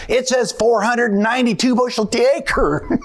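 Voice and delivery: hired man's voice jumps high in amazement; amazed hired man. Retold or imitated. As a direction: pitch it high, unable to believe the number. Hired man reports huge yield.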